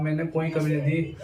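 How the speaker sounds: ordinary talk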